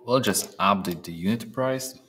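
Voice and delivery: usual speaking voice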